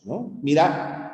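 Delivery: ordinary delivery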